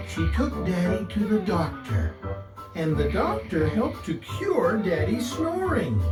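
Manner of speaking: ordinary delivery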